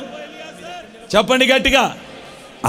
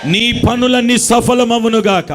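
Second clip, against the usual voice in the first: slow, ringing pulpit declaration, pushing each line out; slow chanted blessing that shouts on the middle lines; forceful blessing proclamation; 4 semitones higher than usual. Acted. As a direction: pronounce the blessing over the crowd with full force.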